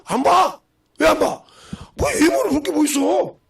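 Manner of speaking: high, loud insistent preacher's retort; emphatic preaching. Real, not acted. Preacher insisting to the congregation that nobody would refuse. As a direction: hammer the answer home to the congregation.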